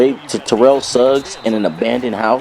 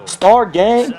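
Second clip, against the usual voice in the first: louder gang call; 10 semitones higher, 4 dB louder than usual. Retold or imitated. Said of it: "All I hear is".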